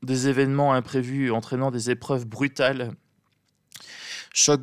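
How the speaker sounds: ordinary talk, nothing marked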